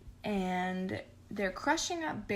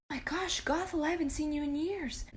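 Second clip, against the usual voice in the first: much higher, delighted surprise; mock surprised greeting; 7 semitones higher than usual. The other king greets Gothel, much higher and delighted. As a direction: gasp with delight at an old friend.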